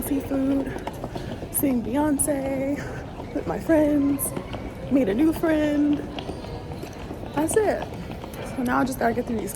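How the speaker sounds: excited. Real, not acted. Back home, thrilled trip is done.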